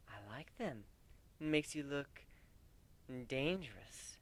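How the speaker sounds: a soft purr for the human. A narrator's reading. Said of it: "she purred again".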